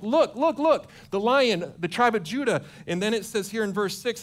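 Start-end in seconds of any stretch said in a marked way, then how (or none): 0.0-2.6 urgent elder. Retold or imitated. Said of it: "it's like".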